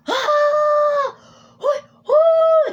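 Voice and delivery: very high, loud pained yelp; crying yell. Retold or imitated. The bully yelping and crying after being hit in the back. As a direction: shriek like a kid hit by a rock. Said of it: "running home and crying".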